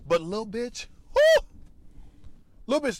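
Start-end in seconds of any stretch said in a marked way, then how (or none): 1.1-1.5 high, loud whoo of delight; excited whoop. Real, not acted. Cheering his favorite insult, an excited whoop. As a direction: let out a big whoop.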